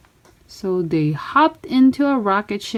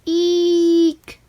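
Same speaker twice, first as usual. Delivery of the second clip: mock terrified squeak; 8 semitones higher, 3 dB louder than usual. Bleep of terror.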